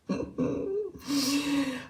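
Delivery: soft, high little laugh; quiet laugh. Real, not acted. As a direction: giggle softly at your own joke. Amused by own wind joke.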